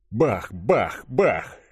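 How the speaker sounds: punchy bursts, each jumping in pitch; voiced gunshots. A narrator's reading. Narrator firing the girl's two plasma revolvers, punchy gunshots. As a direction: sound out three shots.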